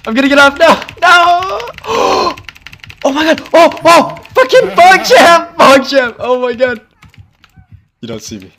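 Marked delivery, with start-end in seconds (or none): 0.1-6.8 high, loud frantic panic; panicked yelling. Real, not acted. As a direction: scream it while fleeing. Chased by the killer, panicking.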